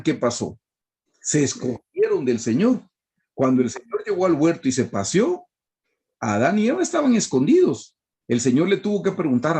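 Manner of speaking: ordinary talk, nothing marked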